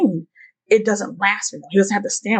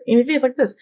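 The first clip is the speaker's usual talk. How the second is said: ordinary delivery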